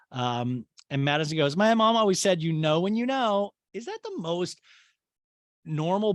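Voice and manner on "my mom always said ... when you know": higher, louder imitation of earnest advice; mock profundity. Madison quoting her mom on love, mock-earnest and raised. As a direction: proudly hand down a cliché, sending it up.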